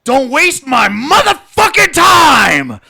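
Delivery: drawn-out enraged scream down the phone; furious yell. Retold or imitated. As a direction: bellow it at the telemarketer, word by word. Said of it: "I just yell".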